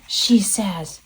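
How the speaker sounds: usual speaking voice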